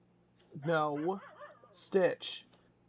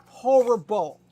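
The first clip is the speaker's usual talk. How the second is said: "horrible" hit loud and higher; loud teasing horrible; 4 semitones higher, 11 dB louder, slower than usual. Real, not acted. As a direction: punch out "horrible" as a mock scold. Teasing the dog.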